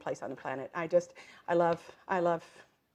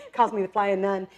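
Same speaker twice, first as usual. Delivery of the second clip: usual speaking voice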